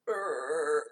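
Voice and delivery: low, soft zombie growl; a quiet undead grunt; zombie groan. Acted. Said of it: "That's a great zombie impression".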